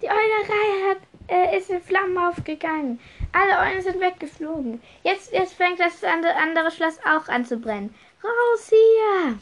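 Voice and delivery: high-pitched, urgent report rising to a shout; high, alarmed voice; panicked alarm. Acted. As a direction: blurt out the bad news, then yell. Messenger raises alarm about the fire.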